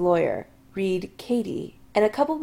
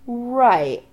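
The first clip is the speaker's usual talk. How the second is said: sarcastic; 3 semitones higher, 5 dB louder than usual. Acted. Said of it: "Adam says sarcastically".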